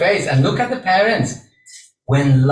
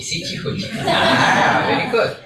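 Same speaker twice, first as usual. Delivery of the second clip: laughing; 7 semitones higher than usual. Real, not acted. Laughing while practising.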